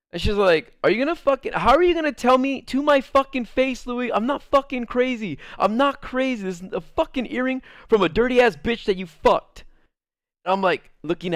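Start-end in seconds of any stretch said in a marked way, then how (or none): 0.8-9.6 high, heated accusing rant; imitating girlfriend's furious rant at him; angry accusation. Girlfriend accusing him of cheating, high and heated.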